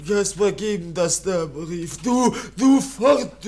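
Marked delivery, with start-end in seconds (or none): 2.0-3.5 loud half-sung cry; childlike shout. Acted. Kaspar recalling the man who sent him off. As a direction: call it out like a child.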